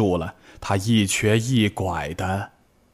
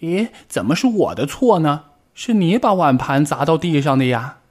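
retorting; 6 semitones higher, 3 dB louder than usual. Acted. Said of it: "立即反驳".

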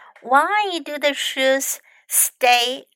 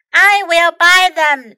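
louder, eager announcement; excitedly; 4 semitones higher, 7 dB louder than usual. A narrator's reading. Jules tells his sister he'll buy them, excitedly. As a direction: burst out with the decision. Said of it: "says Jules excitedly".

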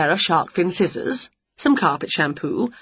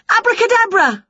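spell cast; 5 dB louder than usual. A narrator's reading. Winnie casts a spell.

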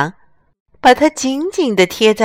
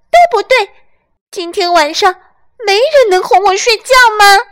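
much higher, louder lamb shout; 13 semitones higher, 6 dB louder than usual. Acted. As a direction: yell like an exhausted lamb. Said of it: "他大声叫起来".

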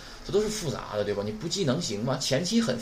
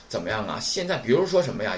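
ordinary delivery

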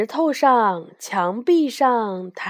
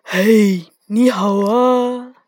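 louder, drawn-out hello in a character voice; friendly dragon greeting; 4 dB louder, slower than usual. A narrator's reading. Dragon at the window hailing Ye Gong, cheerful greeting. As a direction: greet slowly and heartily as a big friendly dragon. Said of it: "笑着打招呼".

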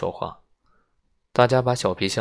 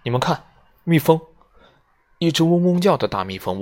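high, loud alarmed cry; young woman crying out at a bee by the window; shouting; 8 semitones higher, 6 dB louder than usual. Acted. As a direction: yell it out as you spot the bee. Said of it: "薇拉大叫".

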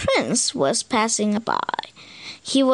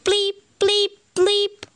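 high electronic bleep sounds; computer bleeps; 7 semitones higher than usual. Acted. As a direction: bleep like a game console. Computer noise in story.

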